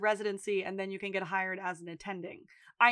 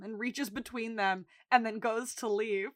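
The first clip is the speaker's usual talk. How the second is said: usual speaking voice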